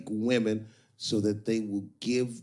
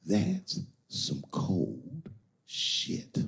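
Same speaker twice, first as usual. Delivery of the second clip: dragged out very slowly, a touch lower; slow reaction; 4 semitones lower, much slower than usual. Real, not acted. Comic reacting to the pimp's advice, very slow. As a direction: let it hang, slow and grim.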